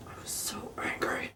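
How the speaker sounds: breathy hushed confession of fury; seething whisper; whispered anger. Real, not acted. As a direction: whisper it through held-in anger.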